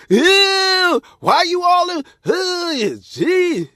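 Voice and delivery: high, loud squealing disgust; disgusted woman. Retold or imitated. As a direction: shriek it like a grossed-out woman. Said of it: "She's like".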